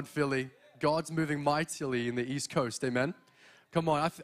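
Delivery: normal talking voice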